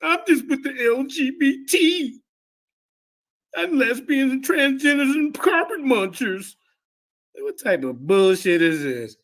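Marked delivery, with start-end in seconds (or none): 0.0-6.6 higher, slower mocking whine; mock whiny victim. Debater mocking an opponent as a victim, higher mock whine. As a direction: whine it like someone playing the victim. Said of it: "when she get treated like".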